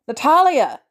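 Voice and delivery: loud high calling shout. A narrator's reading. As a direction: yell her name again. Pounding on door again.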